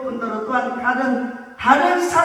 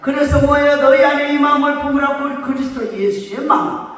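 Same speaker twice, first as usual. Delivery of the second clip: loud, sustained scripture declamation; emphatic pulpit reading, voice held up; forceful preaching; 2 semitones higher, 4 dB louder than usual. Real, not acted. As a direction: proclaim the verses to the congregation with force.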